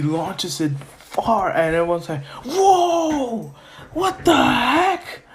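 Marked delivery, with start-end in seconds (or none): 2.4-5.2 high, drawn-out cry of disbelief; stunned amazement. Acted. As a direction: exclaim like an astonished crowd. Class astonished by the throw.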